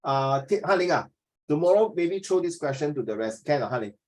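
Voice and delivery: usual speaking voice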